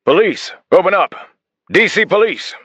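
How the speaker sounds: bellowing high and loud through a door; police shout. Acted. Detective shouting through the apartment door, commanding shout. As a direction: yell it like a cop about to break in.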